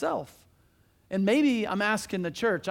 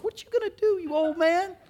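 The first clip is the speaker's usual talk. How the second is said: high mocking voice of a doubter; 9 semitones higher than usual. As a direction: scoff at the old man, pitched way up. Mocking voice of bystanders.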